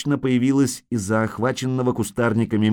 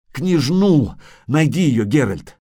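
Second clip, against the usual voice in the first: louder, higher urgent plea; desperate cry; 5 semitones higher, 5 dB louder, slower than usual. Acted. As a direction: beg with the last strength. Dying man begs to save princess. (отчаянный крик)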